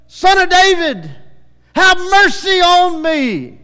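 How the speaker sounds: high, loud desperate plea; crying out for mercy. Retold or imitated. Blind man calling to Jesus as he passes, loud plea. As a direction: beg across the crowd at the top of the voice. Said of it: "he cried out to him".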